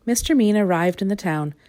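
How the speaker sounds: ordinary delivery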